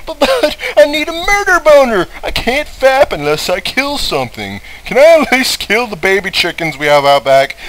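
high, loud hammed-up whine; mock Rambart. Player mocks Rambart whining about needing to kill. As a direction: whine it like a spoiled brat. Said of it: "He's like".

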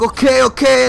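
acting out a pressured yes; hurried agreement. Retelling giving in to friend's urging.

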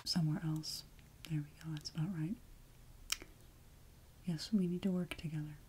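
very soft, low near-whisper; hushed roleplay voice. Pretend. Back in character with the viewer, hushed whisper. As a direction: settle into a quiet whisper.

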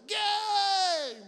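loud, high, drawn-out holler; public yell. Acted. Fan yelling out in public in South Carolina, loud high holler. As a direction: holler the chant out to strangers. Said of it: "you could yell out in public".

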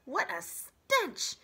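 high, loud, slow badger voice; disgusted badger. Badger recoiling from the rotten corpse, high and loud. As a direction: gag at the smell like a badger. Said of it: "face twisted with disgust".

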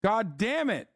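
exasperated curse. Real, not acted. Furious at the app.

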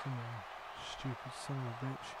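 very low, barely voiced grumble; muttered cursing. Real, not acted. Player muttering at Lesnar after a kick out, very quiet cursing. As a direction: curse under the breath.

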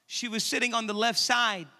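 usual speaking voice

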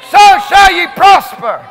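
loud, slow, shouted declaration; preaching at full force. Real, not acted. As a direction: thunder it over the congregation.

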